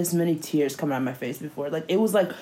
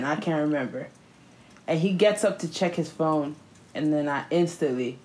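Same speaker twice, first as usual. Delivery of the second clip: ordinary talk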